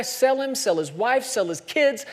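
ordinary talk

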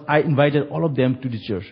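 usual speaking voice

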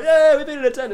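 high, loud crowd reply; mock audience retort. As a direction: call back like an indignant punter. Acts out the audience answering back.

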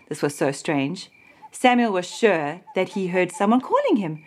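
usual speaking voice

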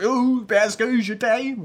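put-on slow drawn-out French with American accent. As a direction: say it like an American crooner. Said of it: "mais avec un accent américain". (accent américain traînant)

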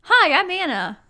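high, loud chirpy hello; bright chipper intro. Retold or imitated. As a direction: introduce yourself all bubbly.

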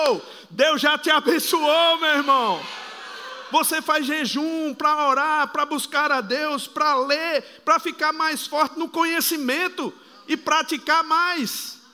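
loud, high fervent preaching. Acted. Pastor exhorting church about fasting, loud preaching. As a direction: preach it hard to the congregation.

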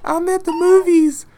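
put-on high, slow, weepy child pleading; whiny crying kid. Retelling the kid's phone call, high crying plea. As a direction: whimper like a scared little boy. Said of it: "he called me almost crying".

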